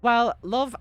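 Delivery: normal talking voice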